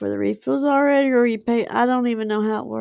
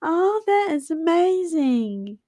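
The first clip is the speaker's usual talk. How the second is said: amazed; 7 semitones higher, slower than usual. Real, not acted. Reacting to sister's news.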